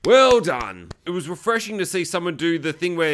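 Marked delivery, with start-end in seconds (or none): 0.0-0.9 loud, punched well done; emphatic approval. Retold or imitated. Applauding the character's sensible choice, loud approval. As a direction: belt out the praise. Repeats praise, much louder.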